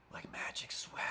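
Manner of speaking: awed whisper. Retold or imitated. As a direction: breathe it out like a secret. Whispered amazement.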